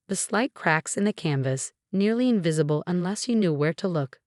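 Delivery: plain reading voice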